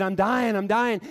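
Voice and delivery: put-on high, loud cries of a dying boy; retold scream. Retelling a shot teenager's cries in the street, screaming. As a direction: cry out urgently, imitating the wounded kid. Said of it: "and he's screaming".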